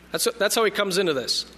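usual speaking voice

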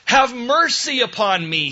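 high, loud plea for mercy; crying out. Acted. Blind Bartimaeus begging Jesus for mercy, crying out. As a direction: call out imploringly, pitch up. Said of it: "When Bartimaeus cried out".

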